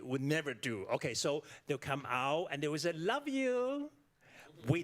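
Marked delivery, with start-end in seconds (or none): 2.9-3.9 bright high sing-song love you; mock American goodbye. Foreigner mimicking families after lunch, sing-song. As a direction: imitate a cheery American parting.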